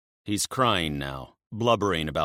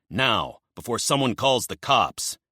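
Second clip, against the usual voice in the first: urgent; 5 semitones higher, 5 dB louder than usual. Acted. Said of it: "Magnus says urgently".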